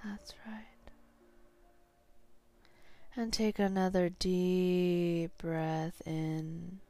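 slow hypnotherapist's guiding voice, long pauses; sleep hypnosis. Real, not acted. Hypnotherapist guiding a listener to sleep, slow and soothing.

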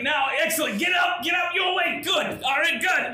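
high, loud, excited host; loud cheering host. Acted. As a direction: shout it like a game show host. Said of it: "Tony's like".

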